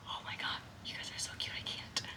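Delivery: breathy whispered gush. Real, not acted. Whispering to baby birds in the nest, hushed. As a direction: whisper so you don't scare them.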